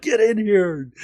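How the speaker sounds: high, excited mock call; calling someone over. Pulling Sarah into the huddle, excited call.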